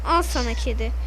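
ordinary talk

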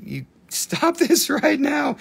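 slow, high, laughing through it. Real, not acted. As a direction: giggle out the scolding. Laughs at viewer's request.

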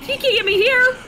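high, loud gloating yell; excited taunting shout. Real, not acted. Player taunts enemy from safe spot, high shout.

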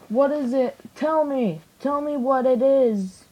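high-pitched, drawn-out plea for an answer; mock demanding. Reviewer begging the toy to say what it is, mock demanding.

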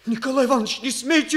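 high, loud cry of protest; pained protest. Acted. Boy cries out against teacher's accusation, high pained shout. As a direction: shout him down, hurt and pleading.

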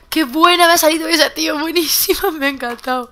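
high, loud burst of delight; real gleeful shouting; thrilled exclamation. Real, not acted. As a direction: crow over a great shot. Delighted at a great shot.

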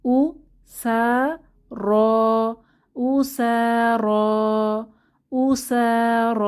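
উ সা র spelled out syllable by syllable, then repeated. Shown as a demonstration. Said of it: "একসাথে পড়ব".

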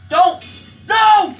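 loud, high cries of protest; alarmed shout. Real, not acted. Gamer watching someone in danger, alarmed shout. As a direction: yell out to stop it.

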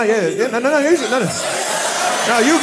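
normal talking voice

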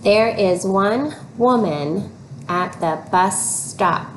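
slow, even reading, each word clear; slow model reading. Shown as a demonstration. Teacher models the sentence slowly for children. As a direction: read it out slowly for the kids to follow. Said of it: "Read it with me".

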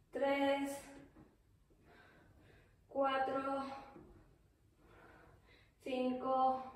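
ordinary talk

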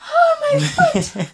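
high shriek with a laugh; pained laughing shriek. Real, not acted. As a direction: yelp and laugh at the cold. Foot into ice water.

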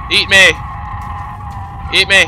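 loud defiant yell; shouting. Real, not acted. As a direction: yell it at the beast. Yelling at attacking dinosaur.

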